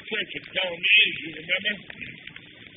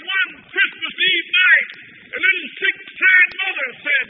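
higher, louder pleading prayer, slowing at the end; impassioned prayer; 8 semitones higher, 7 dB louder than usual. Acted. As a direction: cry out to the Lord at full force. Shouted prayer at sermon climax.

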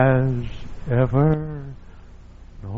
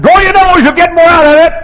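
loud high yell at a tailgater; yelled retort; 14 semitones higher, 10 dB louder than usual. Retold or imitated. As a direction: shout it at the truck behind you. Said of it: "I said".

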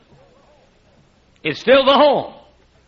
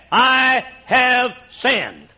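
drawn out, every word stretched; very slow confession; 2 semitones higher, much slower than usual. Pretend. Pastor owning the blame before his people, very slow. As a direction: say it slowly, word by word.

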